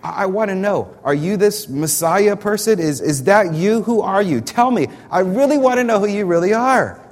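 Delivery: high, loud, urgent demand; desperate to finally know who he is; passionate pleading. Acted. Preacher voicing John, demanding loudly and passionately. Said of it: "You feel the, the passion in that?".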